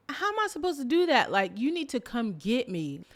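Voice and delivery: high, loud, fast incredulous comeback; acted-out exasperated objection; indignant protest. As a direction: argue back, baffled and put out. Arguing back at the guide's order.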